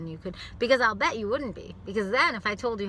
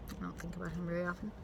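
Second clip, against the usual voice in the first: ordinary talk, nothing marked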